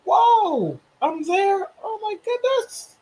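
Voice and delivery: high, amazed gasp of wonder. Retold or imitated. Vlogger reacting to viewers worldwide, high amazed awe. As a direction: squeal it out in awe. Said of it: "I'm like".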